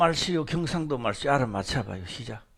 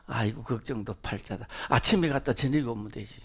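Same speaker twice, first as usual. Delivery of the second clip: joking retort; 9 semitones lower, 8 dB quieter than usual. Retold or imitated. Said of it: "이거는 농담인데요".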